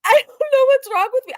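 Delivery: high, loud laughing words; laughing at the clip despite herself; laughing helplessly. Real, not acted. Reactor cracking up at a cringe clip, laughing. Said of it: "I'm laugh-, I don't know".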